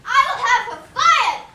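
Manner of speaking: loud, raging yell at the nurse; furious screaming tantrum. Acted. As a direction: scream him out of the room in a fury.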